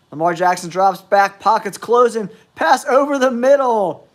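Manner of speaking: building pitch as the play unfolds; sportscaster tension rising to the throw; excited play-by-play. Real, not acted. Live call of a play.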